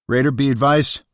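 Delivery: tight, urgent radio call; tense voice. Acted. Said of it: "his voice tense".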